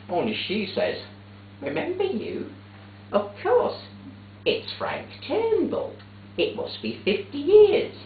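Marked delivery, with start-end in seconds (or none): 1.6-7.9 much higher, mock-delighted old mum; mother's delighted voice. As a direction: play Mother lighting up in recognition.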